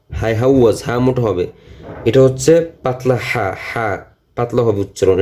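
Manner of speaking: usual speaking voice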